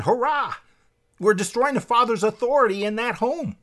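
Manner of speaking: mock cheering. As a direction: crow like a gloating conspirator. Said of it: "behind the scenes they're going".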